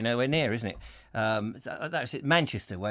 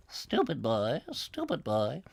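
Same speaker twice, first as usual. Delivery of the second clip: put-on muttered old man voice; 5 semitones lower, 9 dB quieter, slower than usual. Muttered insult in another voice.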